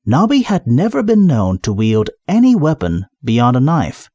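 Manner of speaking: plain reading voice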